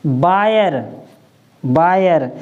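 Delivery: बायर said twice as wire, with a b sound; बायर pronounced clearly for the class; model word. Shown as a demonstration. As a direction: give बायर for the word spelled w-i-r-e. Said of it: "इसको हम क्या पढ़ेंगे".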